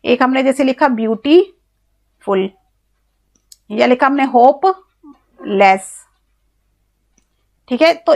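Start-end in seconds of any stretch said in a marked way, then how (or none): 0.9-2.5 beautiful broken as beauty, long pause, फुल; split in two parts. Shown as a demonstration. Teacher giving another syllable example, beautiful split before फुल. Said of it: "दो बार बोलना पड़ रहा है". 4.2-6.0 hopeless said slowly as होप, pause, लेस; split in two parts. Shown as a demonstration. Teacher giving another syllable example, hopeless split into two beats. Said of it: "दो बार बोलना पड़ रहा है".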